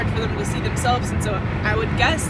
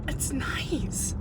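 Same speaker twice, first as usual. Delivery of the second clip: whisper; 3 semitones higher, 7 dB quieter than usual. Real, not acted. Soft aside.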